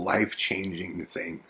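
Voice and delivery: ordinary talk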